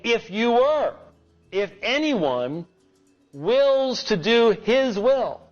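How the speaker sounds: slow emphatic preaching, rising high; booming motivational speech. Acted. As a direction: lean hard on wills, slowly. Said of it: "Jesus is saying".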